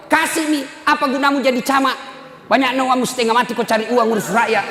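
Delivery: nagging wife. Retold or imitated. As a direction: nag the husband about his job. Wife scolding husband camat.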